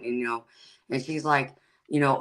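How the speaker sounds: ordinary delivery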